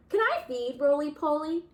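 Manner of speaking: put-on high, loud childlike ask; eager little bear. Bear eagerly asking Nora to feed the baby.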